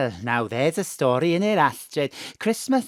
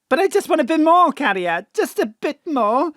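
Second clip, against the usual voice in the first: pleading; 9 semitones higher, 5 dB louder than usual. Acted. Mam begs for more lines.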